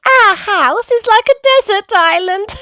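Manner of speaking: very high, amused piglet voice; Peppa. A narrator's reading. Peppa looking at all the water, high amused piglet. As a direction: pitch it way up, ending in a giggle. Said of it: "Peppa laughed".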